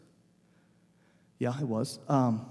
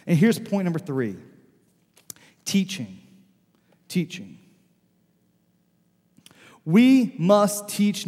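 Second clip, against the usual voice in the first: preaching with force; 4 semitones higher, 4 dB louder than usual. Acted. Preaching the sermon climax.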